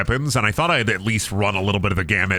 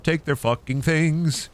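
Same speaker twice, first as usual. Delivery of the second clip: ordinary talk, nothing marked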